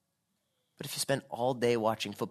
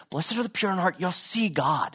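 higher, lilting earnest appeal; pleading; 6 semitones higher than usual. Acted. Preacher voicing Jesus pleading with us, earnest appeal. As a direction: plead with them to take it in. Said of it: "he's pleading with us and going".

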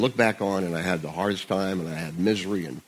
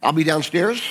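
yelling across the room; 7 semitones higher, 4 dB louder than usual. Real, not acted. Calling out at session's end.

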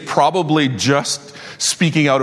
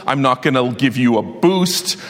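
ordinary delivery

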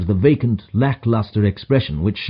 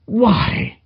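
high-pitched startled exclamation; startled cry; 11 semitones higher than usual. A narrator's reading. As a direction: exclaim in mild shock, pitched up. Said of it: "I cried as I cast my eye over it".

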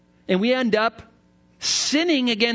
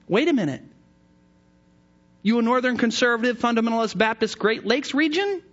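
ordinary talk

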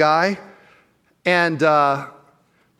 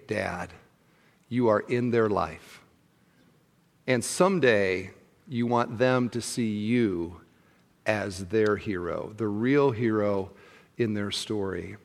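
sincere appeal; 5 semitones lower, 6 dB quieter, slower than usual. Real, not acted. Tender sermon appeal to fathers.

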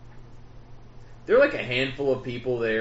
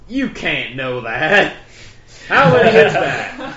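slow country drawl, laughing, then louder; 3 semitones higher, 8 dB louder, slower than usual. Retold or imitated. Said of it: "I'm doing an impression of a redneck".

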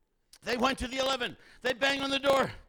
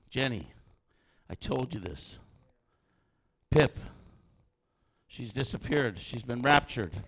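a lightly voiced tender Jesus; gentle Jesus voice; 11 semitones lower than usual. Voicing Jesus speaking gently.

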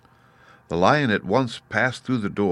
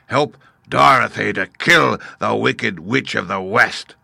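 louder beast command; 3 semitones higher, 5 dB louder than usual. Acted. Beast Oz ordering the Woodman, louder. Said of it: "replied the beast".

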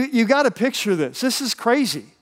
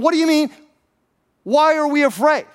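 high, indignant comeback; acted exasperation, pitched well up; incredulous; 8 semitones higher, 3 dB louder than usual. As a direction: throw the question back in disbelief. Imagined disciple throws question back.

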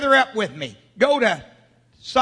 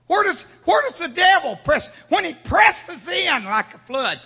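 preaching at full force; 6 semitones higher than usual. Acted. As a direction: rouse the church, call out to them. Preacher shouting a question.

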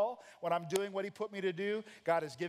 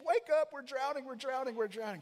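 panicked cry; 6 semitones higher than usual. Acted. Panicked disciples waking Jesus.

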